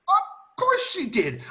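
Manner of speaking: high, drawn-out theatrical exclamation; emphatic contradiction. Acted. Butler correcting a doubter about the cook, emphatic. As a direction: cry it out slowly, as if obvious.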